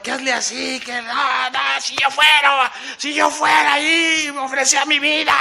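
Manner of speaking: gritos agudos de aficionados exaltados; gritadero imitado. Retold or imitated. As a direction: imita a la plaza dando órdenes al torero. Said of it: "Pero el gritadero". (griterío fingido, alto y sostenido)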